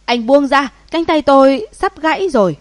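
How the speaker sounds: high, loud cry of pain; agonised yell. A narrator's reading. Thug begs to be let go, agonised yell. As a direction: yell as if your arm is being wrenched. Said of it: "cực kỳ đau khổ mà hét lên".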